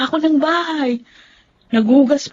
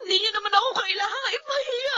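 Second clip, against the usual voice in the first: higher, softer, crying; tearful plea; 5 semitones higher, 7 dB quieter than usual. Acted. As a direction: beg her through tears not to shame you. Granddaughter crying at grandmother. (umiiyak na pakiusap)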